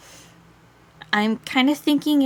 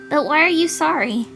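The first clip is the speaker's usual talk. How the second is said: higher, questioning character voice; lightly voiced girl; 7 semitones higher, 4 dB louder than usual. Girl questioning the apology, lightly pitched-up voice. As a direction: ask it with the pitch raised.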